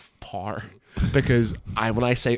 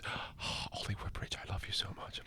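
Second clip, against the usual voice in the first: breathy adoring whisper; mock whisper; 3 semitones lower, 18 dB quieter than usual. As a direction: whisper it like a starstruck fan.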